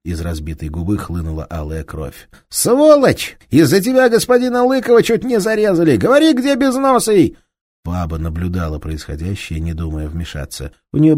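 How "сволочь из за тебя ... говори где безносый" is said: higher, louder furious bellow; angry detective shouting down a thief; enraged police inspector. Acted. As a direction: roar at the thief with fury.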